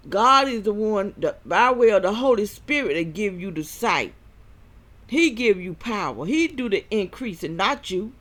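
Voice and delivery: loud, high fervent preaching. Acted. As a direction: preach it hard at the crowd. Preaching against third eye.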